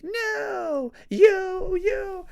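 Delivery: high squeaky mock retort; mocking fan. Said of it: "You are gonna be like".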